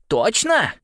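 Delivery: high, loud "Точно" falling at the end; insistent. A narrator's reading. Нэш presses Порша for an answer, insistent.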